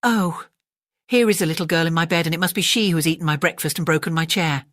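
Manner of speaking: ordinary delivery, nothing marked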